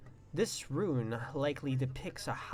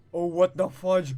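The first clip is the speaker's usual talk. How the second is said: loud, high startled outburst; jolted by a scare; shocked "what the fudge"; 5 semitones higher, 11 dB louder than usual. Real, not acted. Jump scare in game.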